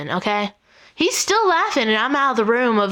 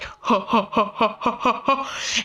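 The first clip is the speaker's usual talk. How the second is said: deep man laugh; 2 semitones lower, 4 dB quieter than usual. Retold or imitated. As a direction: imitate a weird, deep, evenly spaced ha-ha laugh. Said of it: "deep man laugh where it's all like".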